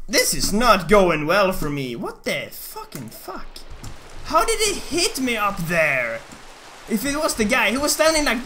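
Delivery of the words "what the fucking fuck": usual speaking voice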